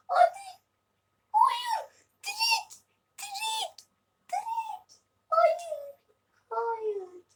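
very high yelps, fading at the end; frantic squealing. Real, not acted. Gamer watching the chance slip away, frantic squeals. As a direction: squeal in panic at the play. Panicked cries during the attack.

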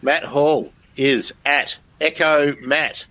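normal talking voice

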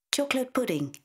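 put-on high little girl voice, a tentative guess; hesitant child guess. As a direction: guess it like an eager small child.

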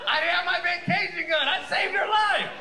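high, loud comic protest; hammed-up indignant yelling; exasperated self-defense. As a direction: shout your excuse in exasperation. Shouted self-justification to audience.

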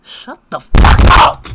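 loud burst of irritation; angry outburst. Real, not acted. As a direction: shout them down. Snaps back after being told no.

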